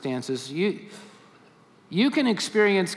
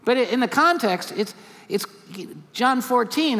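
usual speaking voice

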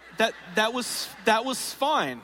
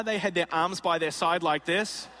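normal talking voice

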